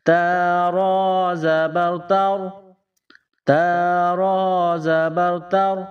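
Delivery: تا را زبر تر drawn out with a thick r; slow heavy ra. Shown as a demonstration. Teacher spelling a heavy را for pupils. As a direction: spell slowly, keep را heavy. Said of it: "اس را کو موٹا پڑھیں گے".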